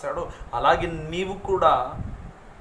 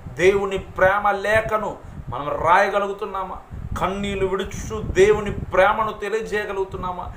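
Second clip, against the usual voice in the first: raised, driving run of challenging questions; impassioned preaching; 4 semitones higher, 4 dB louder than usual. Real, not acted. Preacher challenging believers about what they weep for, impassioned preaching. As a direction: press the congregation with each repeated phrase.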